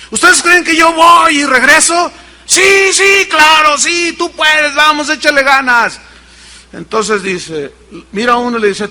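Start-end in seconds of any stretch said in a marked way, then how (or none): 0.1-2.1 high loud showman question; calling out. Acted. Tightrope walker calls to crowd. 2.5-6.0 high excited shouts; cheering crowd. Acted.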